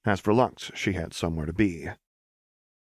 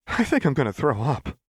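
higher, queasy groan of a voice; queasy Seraphine; 7 semitones higher, 3 dB louder than usual. Acted. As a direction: sound about to be sick. Seraphine sick after match.

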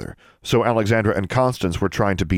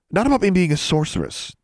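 hasty, higher-pitched correction; quick addition; 11 semitones higher than usual. A narrator's reading. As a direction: jump in quickly to clarify, pitched up. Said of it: "Alexandra added quickly".

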